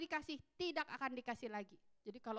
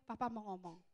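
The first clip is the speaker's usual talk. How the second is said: gentle father; 8 semitones lower, 8 dB quieter than usual. Retold or imitated. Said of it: "orang tuanya panggil".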